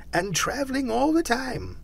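high-pitched wondering voice; musing. A narrator's reading. Scrooge on the ghost's travels, musing. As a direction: puzzle it out aloud, pitched high. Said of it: "mused Scrooge".

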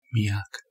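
Miak as a variant of the angel's name; alternative pronunciation. Shown as a demonstration. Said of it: "También se puede pronunciar".

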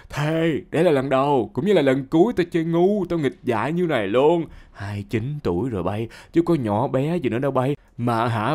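usual speaking voice

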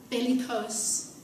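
ordinary talk

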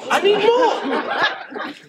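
very slow, high, laughing; drawn-out laughing plea. Retold or imitated. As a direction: stretch it out while laughing.

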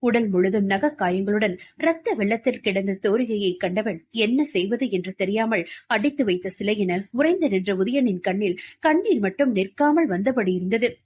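plain reading voice

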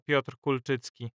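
usual speaking voice

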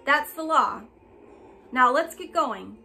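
stern announcement. Acted. Constable lays down the law.

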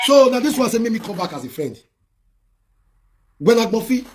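real indignant yell; indignant shout. Real, not acted. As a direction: shout it in disbelief.

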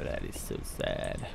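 hushed, slow mock sorrow; whispered sad. Real, not acted. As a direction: breathe it out sadly. Repeats sad quietly.

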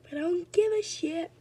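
squeaky high voice; high-pitched voice. Pretend. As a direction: go high and defiant. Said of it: "I gotta stop talking normally".

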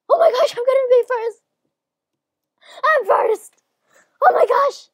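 very high, very loud thrill; overjoyed yelling at a first place; ecstatic shouting. Real, not acted. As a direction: scream it in winning disbelief. Realises they are winning.

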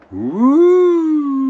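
loud high whoop of delight; excited whoop. Real, not acted. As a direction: cheer out at the reveal. Big reveal of finished bike.

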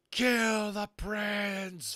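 high, very slow shrieking bird; furious devil bird screaming for blood; hellish shriek. A narrator's reading. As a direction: drag out the shriek from the top of the tower. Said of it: "Flag shrieked hellishly".

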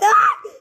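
piercing high scream of fright; terrified shriek at a jump scare; shrill scream. Real, not acted. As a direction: scream as if grabbed.

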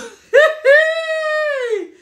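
long, loud, high squeal of laughter. Real, not acted. Host returning to gigolo topic, high squealing laugh. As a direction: burst into a drawn-out shrieking cackle.

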